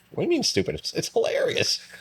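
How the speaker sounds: high, indignant disbelief at being called stupid; a husband defending his silly show, incredulous; mock outrage. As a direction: squeak it out, offended and amazed. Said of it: "I'm like".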